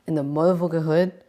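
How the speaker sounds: ordinary talk, nothing marked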